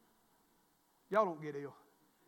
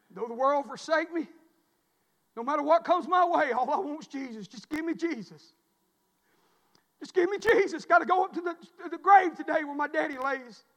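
preaching build; 5 semitones higher, 8 dB louder than usual. Real, not acted. Preaching climax on Jesus.

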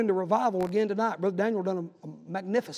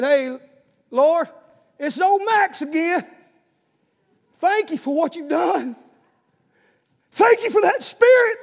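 much higher, louder, slow and fervent, chatting with God; old man's casual prayer; 9 semitones higher, 11 dB louder, slower than usual. Retold or imitated. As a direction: talk to the Lord like a phone call, slow and loud. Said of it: "He didn't pray like that. I promise you that's how he prayed".

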